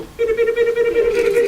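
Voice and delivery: very high, loud Martian babble; piercing high-pitched repeated "bida"; Martian noise. Shown as a demonstration. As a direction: chatter like a cartoon alien. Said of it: "when I say Martian".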